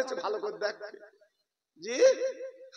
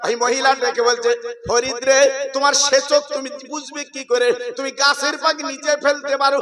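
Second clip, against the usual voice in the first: rising sermon chant, voice carried to the crowd; full-force preaching; 3 semitones higher, 13 dB louder than usual. Acted. Preacher telling the congregation a saint's story, in full preaching voice. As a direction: preach it out with fervour.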